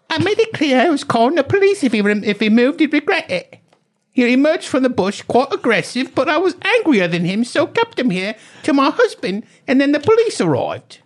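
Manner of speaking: very high mock British-Australian accent; put-on accent. Garden owner recounting the standoff, hammed-up mock British-Australian accent. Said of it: "I'm not doing the accent".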